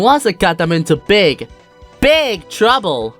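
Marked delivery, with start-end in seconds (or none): none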